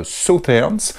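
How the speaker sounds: Southerns in a slow, careful English accent. Real, not acted. Presenter reading a map name, slow self-conscious English accent. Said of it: "excusez-moi pour mon accent anglais".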